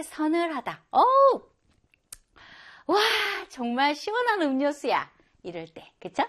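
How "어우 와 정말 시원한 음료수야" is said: delighted exclamation. Acted. Teacher acting someone enjoying a cool drink, delighted. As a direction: gasp with pleasure at a cold drink.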